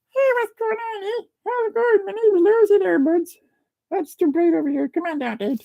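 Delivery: squeaky falsetto pet voice with Canadian hoser talk; tiny, high-pitched hoser critter; Lucy. Pretend. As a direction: speak for the pet in a very high little voice. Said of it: "Lucy's here to say hi".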